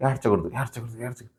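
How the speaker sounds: telling herself not to cry, voice dropping away; holding back tears. Retold or imitated. Contestant steeling herself not to cry, softly fading. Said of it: "నేను ఏడవాలనుకున్నా కానీ".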